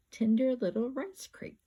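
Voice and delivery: usual speaking voice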